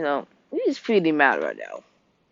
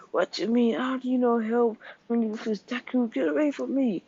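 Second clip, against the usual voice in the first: high, strained protest without extra volume; indignant character voice; 7 semitones higher, 4 dB quieter than usual. Acted. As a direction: act out a high-pitched refusal of help. Bakugo angrily refuses help, acted quote.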